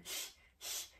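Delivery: ich breathed with the mouth wide; soft unvoiced ich-Laut demo; whispered ich; whispered ich sound. Shown as a demonstration. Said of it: "un ich comme ça avec la bouche".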